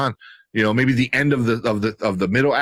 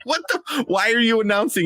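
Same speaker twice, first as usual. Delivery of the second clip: high-pitched baffled protest; incredulous, pitched well up; exasperated disbelief; 11 semitones higher than usual. Retold or imitated. Acted exasperation at announcing attackers.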